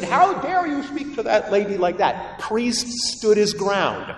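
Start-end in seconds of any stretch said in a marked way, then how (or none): 0.0-2.4 high-pitched retold indignation; mad bishop. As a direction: imitate an indignant scolding, pitched up. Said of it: "the bishop was mad".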